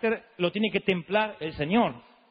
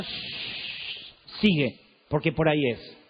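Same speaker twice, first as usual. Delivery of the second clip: soft shush, then a lower calm command; God gently hushing his servant; voz de Dios; 4 semitones lower, 3 dB quieter, much slower than usual. Retold or imitated. (voz de Dios, chistido suave y orden serena más grave)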